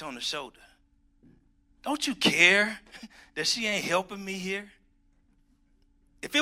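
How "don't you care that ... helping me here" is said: complaining Martha. Acted.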